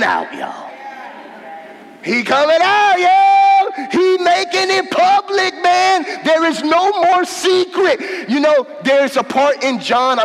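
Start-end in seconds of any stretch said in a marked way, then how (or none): 2.0-8.3 rising, louder preacher's cry, higher; preaching at full force. Acted. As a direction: lift the room, build to a shout. Preaching build-up.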